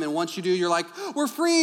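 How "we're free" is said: freed. Retold or imitated. Couple escaping kids for date.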